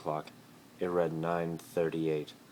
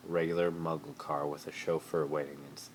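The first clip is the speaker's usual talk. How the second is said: plain reading voice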